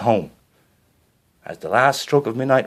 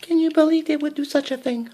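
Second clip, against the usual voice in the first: distressed Cinderella. A narrator's reading.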